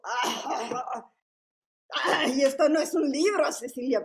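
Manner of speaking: loud scoffing exclamation; old print teacher dismissing the book loudly. Retold or imitated. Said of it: "tenía la voz así muy".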